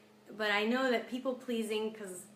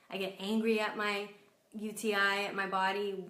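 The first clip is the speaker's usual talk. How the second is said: ordinary talk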